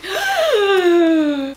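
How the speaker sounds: sharp delighted gasp; excited gasp. Real, not acted. Gasp of delight at seeing the shirt.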